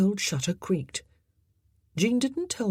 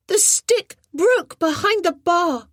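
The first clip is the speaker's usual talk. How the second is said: put-on high, slow, louder boy; 13 semitones higher, 8 dB louder than usual. A narrator's reading. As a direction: speak up so she hears. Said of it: "he said louder".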